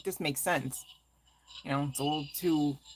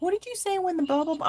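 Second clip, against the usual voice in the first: imitating curious viewers; 13 semitones higher, 3 dB louder, faster than usual. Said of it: "people are like".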